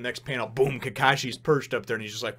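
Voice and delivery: usual speaking voice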